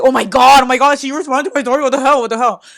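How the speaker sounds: loud, high, fast squealing disbelief; excited disbelief. Retold or imitated. Reacting to her crush's reply, loud excited squeal. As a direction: shriek it like you can't believe she replied.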